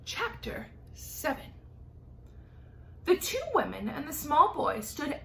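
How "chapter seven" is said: ordinary delivery, nothing marked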